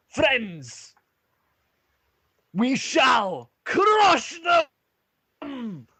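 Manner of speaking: high, loud, drawn-out war shout; dwarf warrior rallying his friends; roused battle cry. Acted. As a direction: build from a hailed greeting to a stretched yell. Dwarf hero rallies friends before battle.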